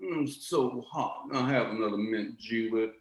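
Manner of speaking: put-on slow, languid old Southern gentry voice; genteel Southern drawl. Yank mocking Southern planter leisure, slow languid drawl. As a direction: savour each word like a lazy planter on a porch. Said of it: "landed class of the South had a type of refinement".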